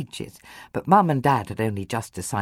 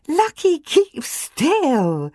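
giggling; 14 semitones higher, 4 dB louder, much slower than usual. Acted. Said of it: "Georgia giggled".

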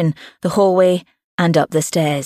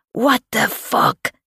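higher, slow indignant shout at normal volume; stepdad's yell; 6 semitones higher, slower than usual. A narrator's reading. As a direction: drag out a shocked, angry yell without full volume. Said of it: "her stepdad yelled".

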